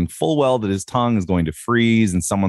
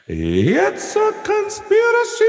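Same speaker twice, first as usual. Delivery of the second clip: dramatic announcer; slower than usual. Acted. Dramatic show name sign-off.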